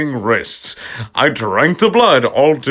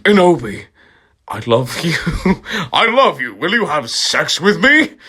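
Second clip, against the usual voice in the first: mock lovestruck; 6 semitones higher than usual. Snake Tail declaring love.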